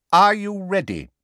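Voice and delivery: high, loud call down a row. A narrator's reading. Fudge calling down the row, calling out. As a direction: call it out to someone along the bench. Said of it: "he called down the row".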